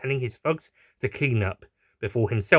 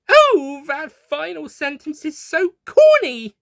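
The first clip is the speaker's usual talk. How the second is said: high, loud, slow mock cringe; amused groan at corniness; 7 dB louder, slower than usual. A narrator's reading. Doctor reading the last line, high amused mock cringe. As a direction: groan at the corny line, laughing. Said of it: "said and laughed".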